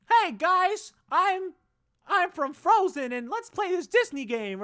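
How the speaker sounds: mock kiddie-channel host, high and loud; high, loud, over-sweet greeting; family-friendly YouTuber. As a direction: squeal a fake cheery video intro.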